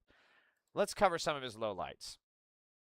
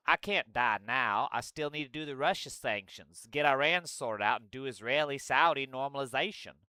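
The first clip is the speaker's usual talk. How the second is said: drawling Southern accent impression; Lindsey Graham's accent. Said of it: "I gotta do it in his accent".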